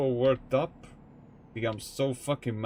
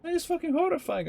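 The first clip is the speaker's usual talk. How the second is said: much higher, fast squeaky horror, hammed-up; mock horrified; faster than usual. Reacting to their own menacing thoughts, mock horrified squeak. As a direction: feign shock at your own dark mind.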